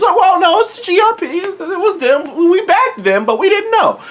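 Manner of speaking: high, squeaky fake-innocent voice; hammed-up high denial with a laugh; mock innocent excuse. Mocking the government's excuse.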